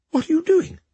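very high, baffled question; bewildered. A narrator's reading. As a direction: ask in disbelief, voice leaping up. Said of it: "said the bewildered princess".

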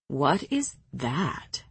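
slow, low, disgusted question; low disdainful voice. A narrator's reading. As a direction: sneer it slowly. Devlin asks in disgust.

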